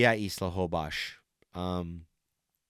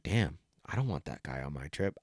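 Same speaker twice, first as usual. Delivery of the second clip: grumbling aside; 9 semitones lower, 10 dB quieter than usual. Retold or imitated. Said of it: "I'm just like".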